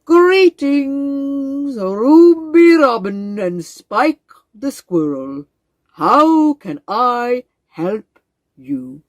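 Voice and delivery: slow, booming, stately frog voice; a proud old frog greeting his visitors; grand wise frog. Acted.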